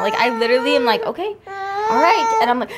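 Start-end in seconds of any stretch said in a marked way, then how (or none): 1.0-2.4 high, slow, anxious whimper; retold nervous squeak, drawn out; nearly crying. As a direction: imitate yourself close to tears. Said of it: "about to cry".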